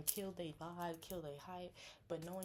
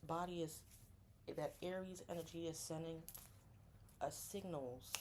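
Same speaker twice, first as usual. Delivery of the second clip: normal talking voice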